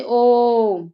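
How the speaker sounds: ओ held three times as long as a short vowel; prolonged pluta vowel. Shown as a demonstration. Teacher demonstrating a pluta vowel to students, long drawn-out ओ. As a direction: stretch the ओ out long and steady. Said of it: "ह्रस्व स्वर से तीन गुना समय".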